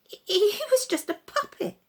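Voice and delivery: high, stammering, uneasy scorn; scornful and doubtful. Acted. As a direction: scoff but lose your nerve. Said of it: "said the puppet master scornfully, doubtfully".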